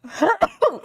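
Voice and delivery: loud sudden sneeze, high burst; a real sneeze bursting out; sneezing. Real, not acted. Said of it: "That time I sneezed".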